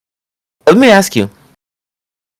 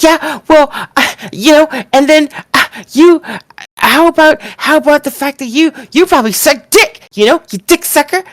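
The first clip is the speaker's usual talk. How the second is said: high mock screech like a furious twelve-year-old; higher-pitched mock tantrum voice, spitting out the insults; mocking Tiffany's screaming; 10 semitones higher than usual. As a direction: squeal it out like a bratty kid throwing a tantrum. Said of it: "started screaming like a twelve-year-old".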